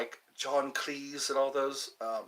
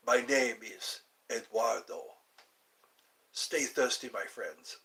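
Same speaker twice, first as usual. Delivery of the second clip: put-on Latin accent; 5 semitones lower, 5 dB quieter than usual. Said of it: "That sounds very Latin".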